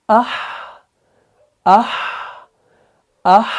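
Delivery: "आह" with a soft, lingering breathy h. Shown as a demonstration. Teacher bringing weakness into ha, "आह" with fading breath. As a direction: let "आह" sink away into air. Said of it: "पस्ती लानी है। कैसे".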